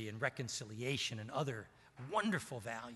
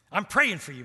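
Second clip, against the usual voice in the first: loud and higher, fervent; Steve's fervent insistence; 6 semitones higher, 12 dB louder than usual. Retold or imitated. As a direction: insist with full conviction. Steve repeats.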